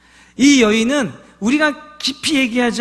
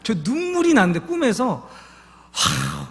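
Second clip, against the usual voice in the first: usual speaking voice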